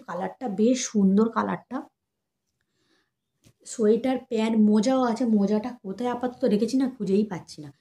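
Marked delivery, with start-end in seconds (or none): none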